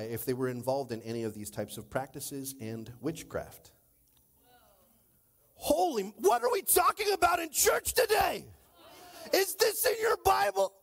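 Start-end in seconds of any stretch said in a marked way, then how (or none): 5.5-10.7 high, loud astonished shout; astonished outcry. Real, not acted. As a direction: throw it at the church in disbelief. Preacher exclaiming at congregation.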